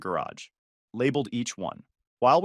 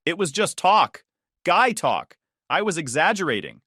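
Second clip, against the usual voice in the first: higher, louder, mildly pleading defense; defensive protest; 5 semitones higher, 5 dB louder than usual. A narrator's reading. Exposed lover excusing himself to the husband, defensive. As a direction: protest as if caught out.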